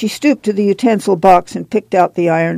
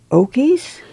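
higher, rising bewildered echo; puzzled repeat; 7 semitones higher than usual. A narrator's reading. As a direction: repeat the word in disbelief. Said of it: "She looked puzzled".

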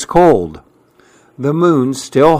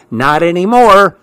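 snuffy; 6 semitones higher, 4 dB louder than usual. Acted. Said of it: "snuffed the porcupine".

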